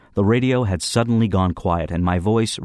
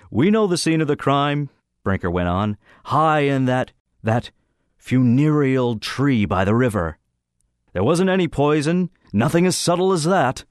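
higher, pompous schoolboy prosecutor; Brinker's mock trial voice; 7 semitones higher than usual. A narrator's reading. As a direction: play the self-important accuser.

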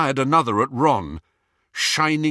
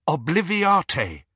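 calm spell; 6 semitones higher, slower than usual. A narrator's reading. Calming breath, casting charm.

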